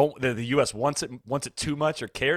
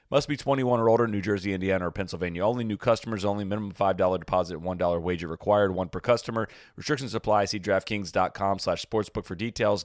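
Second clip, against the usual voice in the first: fast legal disclaimer; 6 semitones lower than usual. Acted. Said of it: "legal fine print in ad".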